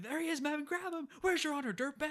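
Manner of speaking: high, barked soldier commands; gung-ho grunts grabbing a traitor; army men shouting. Retold or imitated. Army men seizing Woody, with high, barked shouts. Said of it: "the army men all jump".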